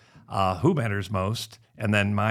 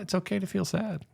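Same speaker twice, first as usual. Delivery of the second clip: soft, higher and tender reassurance; softly telling son it's okay; gentle comfort; 9 semitones higher, 4 dB quieter than usual. Retold or imitated. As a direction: ease him into it kindly.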